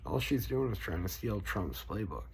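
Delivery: usual speaking voice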